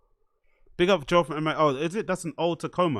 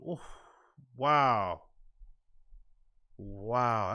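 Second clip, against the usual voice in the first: normal talking voice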